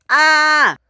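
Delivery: loud high yell; scream. Acted. Engine thrown through the air, loud scream.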